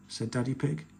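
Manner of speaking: plain reading voice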